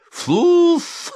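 very high, loud explosive bark; barked shout. A narrator's reading. Sleeper frightens off the imagined plotters, high barked shout. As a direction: bark the nonsense word to scare them off. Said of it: "рявкнул он".

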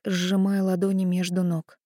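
ordinary delivery, nothing marked

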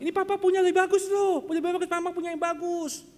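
put-on high, loud sales pitch to a child; coaxing parents. Parents tempting child with better sweets. (membujuk anak dengan suara tinggi lantang)

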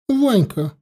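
put-on a touch of sulky grumbling, as a girl; grumpy daughter. A narrator's reading. Daughter answers who chose before, grumpily. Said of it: "сердито буркнула доча".

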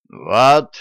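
sudden loud exclamation. A narrator's reading. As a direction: shove the word out. King pushes the receipt forward.

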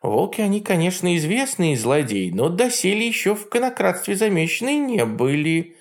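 high mocking old witch; Baba Yaga voice. A narrator's reading. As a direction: sneer like a jealous hag. Yaga speaks.

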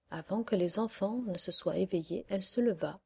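usual speaking voice

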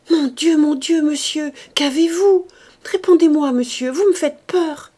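high, loud panicked cries; frightened cry. Acted. Maid crying out in fear to her stricken master, high and loud. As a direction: cry out in terror at the master's bedside.

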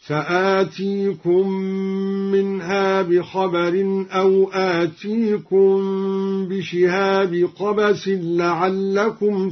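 "بشهاب قبس" as bishihabi qabas, chanted slowly; slow tajweed chant, no n at the end of "بشهاب"; dropped tanween. Shown as a demonstration. Teacher demonstrating al-Duri, "بشهاب" without tanween in slow chant. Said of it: "بحذف التنوين".

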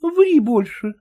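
high scoffing wife; dismissive wife. Acted. Wife scoffing at husband, high voice. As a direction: brush him off as the wife.

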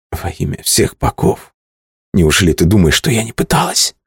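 shaky, breaking voice, half whispered. Acted. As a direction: let the voice crack on the plea. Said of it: "Тут голос её дрогнул". (дрожащий, срывающийся голос)

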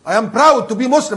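slow, loud English line with stress on proud; preacher proclaiming pride in faith; proud declaration. Shown as a demonstration. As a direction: declare it slowly, leaning on proud. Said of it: "ده أنا مسلم".

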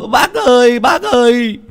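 high, loud frantic shouting; father screaming to neighbours for help; panicked calling out. Acted. Father calls villagers for help.

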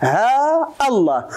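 "ها الله" slow, haa held for two beats, then glottal stop; long vowel, cut hamza. Shown as a demonstration. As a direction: draw out "ها" and cut before "الله". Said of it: "الألف ديالها جوج حركات". («ها» ممدودة ثم همزة «الله» مقطوعة)

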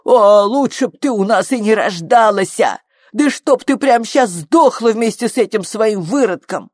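high, loud vicious cursing; enraged yelling. A narrator's reading. Mother wishing her daughter never born, enraged yelling. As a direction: curse her at the top of your temper. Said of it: "заорала на дочь".

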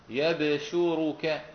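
يبشرك as slow yab-shu-ru-ka; light-sheen reading. Shown as a demonstration. Teacher repeating Hamza's reading, يبشرك as yab-shu-ru-ka. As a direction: recite يبشرك drawn out, with still b and single sh. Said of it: "كما كنت أقرأ أنا قبل قليل".

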